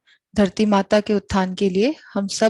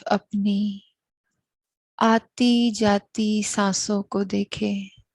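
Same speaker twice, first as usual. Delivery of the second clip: very slow, drawn-out meditation guidance, long pauses between phrases; guided meditation; 3 dB quieter, much slower than usual. Real, not acted. Host guiding listeners to watch their breath, slow meditative voice. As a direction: lead them into stillness, unhurried and even.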